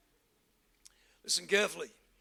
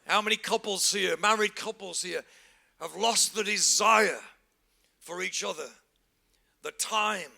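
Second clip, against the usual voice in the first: ordinary talk, nothing marked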